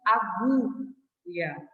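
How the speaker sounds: "agulha" very slowly, split as "aguuu-lha"; U sounded. Shown as a demonstration. Teacher explaining why it is no digraph, "agulha" with long drawn-out U. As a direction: hold the U in "agulha", then finish. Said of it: "eu pronuncio o som do U".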